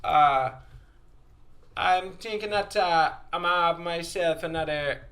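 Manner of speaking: halting, over-careful Jamaican King's English; formal Jamaican accent. Retold or imitated. Jamaican ordering at the bar formally, careful Jamaican accent. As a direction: imitate a thick Jamaican accent trying to sound proper. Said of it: "when they try to be more formal".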